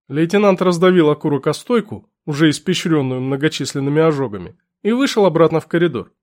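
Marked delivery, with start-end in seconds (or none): none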